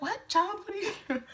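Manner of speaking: high-pitched mock alarm; startled mother. Mother startled while chopping oxtail, high and alarmed. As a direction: imitate a mom caught off guard.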